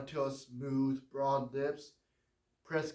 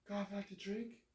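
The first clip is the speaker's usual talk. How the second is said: higher, lighter woman's voice; frail woman; 5 semitones higher, 9 dB quieter than usual. Acted. Dying warden's wife asks John about his scars, high and gentle. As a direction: ask it gently, a sick woman wondering.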